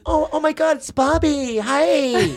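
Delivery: high-pitched, gushing mock greeting; Amy Poehler. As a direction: imitate a delighted, squealed hello. Amy's delighted greeting retold.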